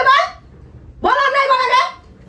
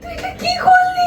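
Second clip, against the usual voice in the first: slow, much higher crying shout; 8 semitones higher, slower than usual. Acted. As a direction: wail the question out in shock. Wife smashes the TV.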